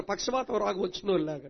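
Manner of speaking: usual speaking voice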